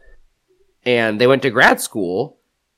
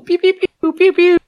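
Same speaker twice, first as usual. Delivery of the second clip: high zapping pew pew sounds; laser pew noises; 4 dB louder than usual. Pretend. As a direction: fire off toy laser noises. Mock laser sound effect.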